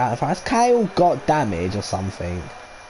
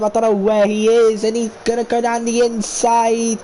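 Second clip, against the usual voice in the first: excited commentary; 8 semitones higher than usual. Real, not acted. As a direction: call the overtake at full pitch. Live race battle, commentator shouting.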